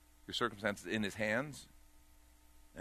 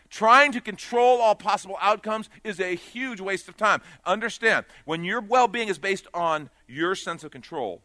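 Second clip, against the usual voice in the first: loud, rising preacher's call; full-force preaching; 8 semitones higher, 10 dB louder than usual. Acted. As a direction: drive it home to the congregation, louder.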